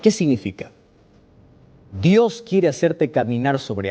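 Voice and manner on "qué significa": usual speaking voice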